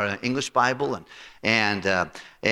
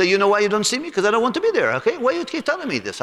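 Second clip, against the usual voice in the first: high, impatient owner's voice; the owner telling the pastor to stop; mimicking Bali; 9 semitones higher, faster than usual. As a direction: push it up high, irritated. Bali's retort retold.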